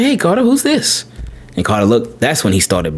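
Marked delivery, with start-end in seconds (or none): 0.0-1.0 high, sing-song mother's voice; teasing mom voice. Retold or imitated. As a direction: playfully dangle the surprise in front of the child. Wife shows son dog photo.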